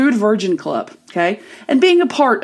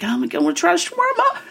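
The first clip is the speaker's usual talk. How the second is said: giddy; 5 semitones higher, 4 dB quieter, faster than usual. Retold or imitated. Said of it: "I was like".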